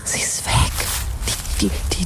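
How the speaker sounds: hushed whisper, sneaking in at night. Acted. Sneaking onto the fenced-off dig site at two in the night, whispering. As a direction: whisper so no one catches you.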